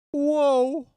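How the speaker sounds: very high, loud sitcom whoa; Joey Lawrence whoa. Shown as a demonstration. Streamer answers the super chat with a loud, very high Joey Lawrence whoa. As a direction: squeal it out like a nineties sitcom catchphrase. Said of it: "That one's more like".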